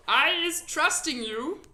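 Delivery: loud, folksy friendly giant. A narrator's reading. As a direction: play the big kind giant.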